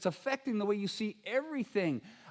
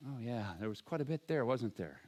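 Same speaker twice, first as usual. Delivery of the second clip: mock sheepish disciples; 10 semitones lower, 8 dB quieter than usual. Disciples mocked answering Jesus.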